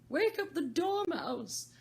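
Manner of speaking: high, loud, slow shout; calling out. Acted. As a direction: shout to wake someone. Calling out in story.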